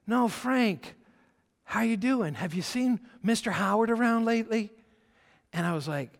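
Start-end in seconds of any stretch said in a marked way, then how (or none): 0.1-4.7 put-on higher-pitched, insistent drunk stranger; lightly voiced drunk. Drunk stranger greeting the speaker as Frank, lightly imitated. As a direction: imitate a drunk pressing his question. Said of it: "this guy who's drunk off his rocker".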